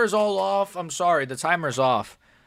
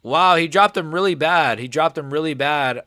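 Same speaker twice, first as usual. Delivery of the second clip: ordinary talk, nothing marked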